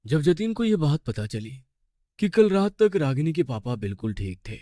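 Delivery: plain reading voice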